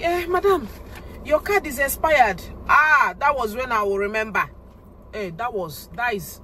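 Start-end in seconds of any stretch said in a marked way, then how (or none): 0.0-2.4 a higher, mock polite bank clerk; bank staff. Bank teller telling a customer her card expired, mock polite. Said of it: "they will now see and tell me".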